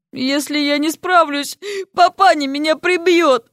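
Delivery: high, slow woeful whine; miserable muttering. A narrator's reading. Failing student dreading his father, whining miserably. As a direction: wail it mournfully, close to tears. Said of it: "с тоской пробормотал он".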